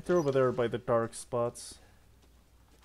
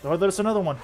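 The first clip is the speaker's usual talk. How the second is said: excited; 5 semitones higher, 8 dB louder, much faster than usual. Real, not acted. Enemy appears suddenly.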